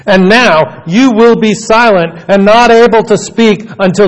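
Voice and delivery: loud, commanding angelic proclamation; booming messenger of God; Gabriel. Acted. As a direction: declare it with weight and authority, pausing between phrases. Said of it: "The angel said to him".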